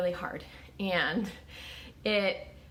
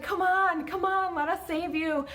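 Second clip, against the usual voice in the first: high urgent calls from above, much higher; calling out; 9 semitones higher than usual. Acted. Helicopter rescuers calling to the stranded pastor, raised urgent voice. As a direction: beckon him up the ladder, urgently.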